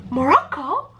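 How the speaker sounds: high, questioning mock-puzzled voice; imitated puzzled reaction. Japanese people hearing of her country, imitated high puzzlement. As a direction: imitate a baffled listener, pitched well up. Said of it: "I mean, with their accent".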